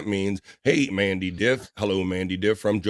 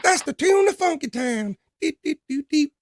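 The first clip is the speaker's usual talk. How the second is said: squeaky cartoon voice. Funky Town bit said again.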